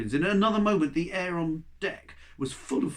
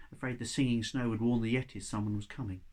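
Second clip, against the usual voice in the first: ordinary delivery, nothing marked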